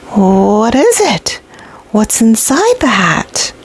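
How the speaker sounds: slow, breathless whisper of wonder; hushed whisper. A narrator's reading. Boy whispering into the hat, slow and awed. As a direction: whisper it like a secret.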